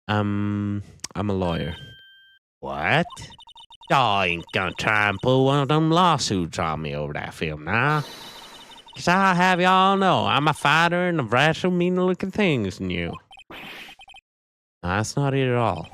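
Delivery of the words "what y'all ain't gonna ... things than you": lightly voiced defiant Southern woman; a touch of country bravado, squaring up; a Southern woman warning the lawyers off in a Southern accent. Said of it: "the southern accent and attitude".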